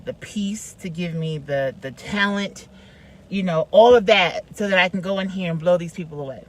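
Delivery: normal talking voice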